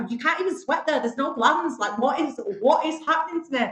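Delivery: baffled outburst, retelling your own inner panic; incredulous self-talk. Retold or imitated. Speaker recalls asking herself about boardroom sweat, incredulous.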